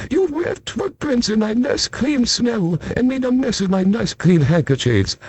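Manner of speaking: high, fussy grumble; a hint of a prim, cross neighbour scolding; fussy complaining. Mister Fussy scolds the lost narrator, high and fussy.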